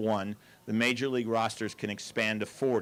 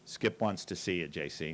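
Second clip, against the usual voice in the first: grim and quiet, dropping low at the end; hushed, solemn summons; 6 semitones lower, 6 dB quieter than usual. Retold or imitated. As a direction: deliver bad news softly and gravely. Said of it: "kinda grimly says".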